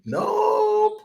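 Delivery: a teasing squeak of a no; mock nope. Mocking answer to own question.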